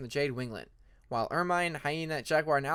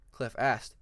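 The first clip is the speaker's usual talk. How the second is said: plain reading voice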